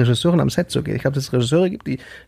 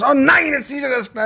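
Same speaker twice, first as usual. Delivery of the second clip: mock dismay; 9 semitones higher than usual. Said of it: "wenn die Kinder was umgekippt haben".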